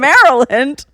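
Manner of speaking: loud, high disbelieving echo; incredulous. Retold or imitated. Mocking mom's excuse for her accent, incredulous. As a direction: throw it back as an absurd question.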